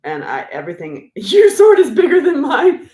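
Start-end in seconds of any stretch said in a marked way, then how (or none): 1.2-2.9 high, loud, laughing through the words. Real, not acted. Reading a viewer's cheeky comment aloud, laughing hard. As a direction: crack up while reading it out.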